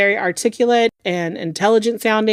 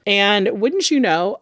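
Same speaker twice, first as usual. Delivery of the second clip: usual speaking voice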